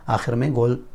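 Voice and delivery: normal talking voice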